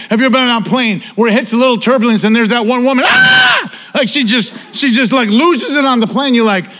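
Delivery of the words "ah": sudden shrill scream, much higher; mock panicked scream. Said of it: "this woman is screaming".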